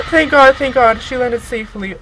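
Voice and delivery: loud, high burst of relief; huge relief. Real, not acted. Player relieved at a safe landing, huge relief. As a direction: exclaim it breathlessly relieved after the gasp.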